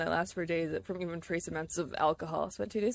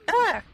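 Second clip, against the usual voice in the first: startled yelp; 12 semitones higher, 7 dB louder than usual. Real, not acted. Startled by a creature in game.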